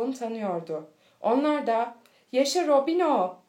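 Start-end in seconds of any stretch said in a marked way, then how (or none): 2.3-3.4 loud, high joyful cheer; cheering shout. A narrator's reading. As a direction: cheer the hero on. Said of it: "diye bağırıyorlardı".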